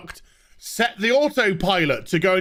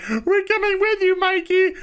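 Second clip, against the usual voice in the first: mock weepy crew; 12 semitones higher than usual. Ranter mocking the crew's teary vow to Mikey, high gushing wail. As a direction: ham up a blubbering pledge to Mikey.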